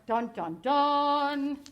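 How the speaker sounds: dramatic sting. Pretend. Teacher's dramatic villain sting.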